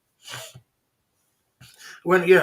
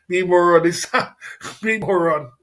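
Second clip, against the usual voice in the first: laughing; 6 dB louder than usual. Real, not acted. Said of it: "mocking the commenter's name".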